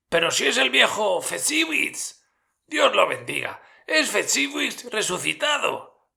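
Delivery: mucho más agudo y fuerte, lleno de asombro; exclamación agitada. A narrator's reading. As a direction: exclama con alegre agitación. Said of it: "no pudo menos de exclamar lleno de agitación". (Scrooge reconoce a Fezziwig, agitado y agudo)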